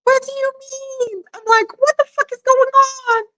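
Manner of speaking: high, baffled squeak; hammed-up disbelief; mock bewilderment.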